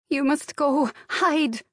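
put-on high, insistent Slavic-accented plea; urgent Slavic accent. A narrator's reading. Maid urging employer to hide, urgent Slavic accent. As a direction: press him to flee in a Slavic accent. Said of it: "her strong Slavic accent".

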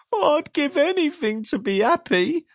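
high, squeaky longing; sad squeaky voice. Acted. Mister Miserable longs to be happy, sad and squeaky. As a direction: sigh it out wistfully. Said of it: "a sad, squeaky sort of voice".

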